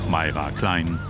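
ordinary talk, nothing marked

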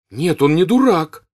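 high, loud, hurt protest; offended and serious. A narrator's reading. Natasha to her mother, offended and serious. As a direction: defend him, wounded. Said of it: "обиженно и серьёзно".

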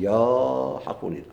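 slow, careful يا with a short open a, not ليا; correct pronunciation. Shown as a demonstration. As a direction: spell out يا clearly and slowly. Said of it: "قل". (نطق صحيح ومتأنٍ لـ يا)

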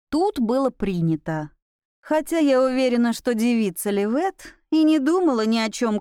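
usual speaking voice

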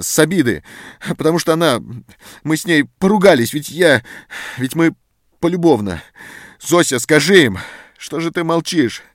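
loud, high, panicky begging; desperate pleading. A narrator's reading. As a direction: plead for your life, voice straining. Prisoner begs for his life.

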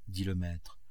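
plain reading voice

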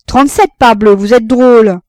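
loud, high, astonished retort; puzzled exclamation. Acted. Citizen retorts to Frédéric's odd question, loud and astonished.

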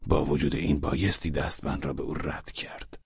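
soft, sullen inner voice; grumpy thought. Acted. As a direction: grumble it to yourself.

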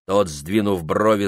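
usual speaking voice

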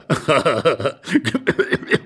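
wheezy chortle; amused old man's laugh. Acted. Husband laughing at the intruder, helpless chortle.

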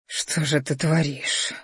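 slow, hushed, husky murmur; slowly, under the breath, thickened by desire; hoarse with arousal. A narrator's reading. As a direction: let the voice drop soft and husky. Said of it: "Мой голос охрип".

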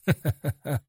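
short chuckle in character; inner voice's laugh. A narrator's reading. Inner voice laughs at its own joke, short laugh. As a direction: laugh briefly as the mysterious voice. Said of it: "Голос засмеялся".

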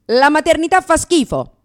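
triumphant call to ex. Retold or imitated. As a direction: announce it in gleeful triumph. Calling ex in triumph.

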